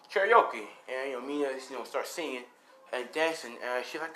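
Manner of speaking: ordinary delivery, nothing marked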